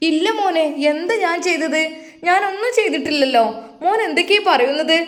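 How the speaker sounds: a mother pleading she did nothing wrong; defensive mother. Acted. Mother denies to her son.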